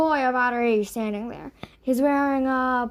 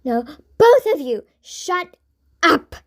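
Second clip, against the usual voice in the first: loud, higher shouted order; scream; 4 semitones higher, 8 dB louder than usual. A narrator's reading. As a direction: yell at both to stop. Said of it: "screamed Sungha".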